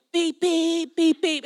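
car horn toot. Retold or imitated.

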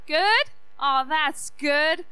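normal talking voice

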